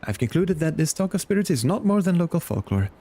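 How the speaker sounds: hesitant, lightly acted scholarly reassurance; reassuring scholar. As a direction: play a formal scholar brushing off worries. Voices game character's conclusion.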